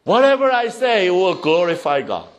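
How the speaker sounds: each phrase launched high, then falling; fervent preaching. Real, not acted. Preacher longing to glorify God perfectly, fervent. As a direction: preach it fervently, punching the last word.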